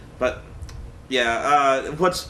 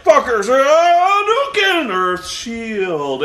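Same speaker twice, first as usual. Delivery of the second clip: much higher, louder, slow Hadouken then flat shield; hammed-up attack cry; 7 semitones higher, 5 dB louder, much slower than usual. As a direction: yell the move then drop to a dull block call. Mocking the movie's fight exchange.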